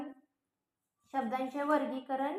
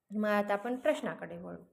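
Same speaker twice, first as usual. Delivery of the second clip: ordinary talk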